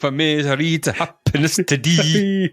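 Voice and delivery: put-on slow, high, sung in a Scottish accent. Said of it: "it's so Scottish".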